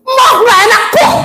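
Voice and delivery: very high, whining-pitched protest; complaining blind man. Acted. Blind man rejecting the food he is fed, complaining. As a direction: shout a high-voiced complaint.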